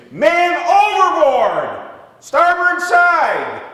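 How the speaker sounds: screamed high and slow, full urgency; man overboard shout. Shown as a demonstration. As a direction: bellow it across the deck, drawn out. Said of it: "that, that's painful".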